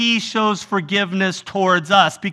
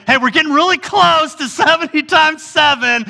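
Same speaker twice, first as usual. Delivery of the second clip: teasing wife; 7 semitones higher, 3 dB louder than usual. Retold or imitated.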